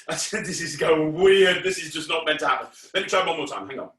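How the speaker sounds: normal talking voice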